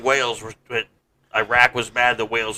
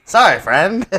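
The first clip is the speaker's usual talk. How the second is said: higher, louder South Park Canadian; chirpy mock Canadian apology; Canadian accent; 6 semitones higher, 8 dB louder, slower than usual. Killer apologizes to a victim in mock Canadian accent. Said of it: "sound like a South Park character".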